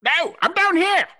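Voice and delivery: tiny high squeaky gnome. Acted. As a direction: pipe up from far below, high and bright. Gnome character speaks up.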